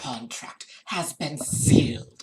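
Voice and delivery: slow, ominous mock whisper; dramatic whisper. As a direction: hiss it slowly like a sinister narrator. Echoing the game's ominous line.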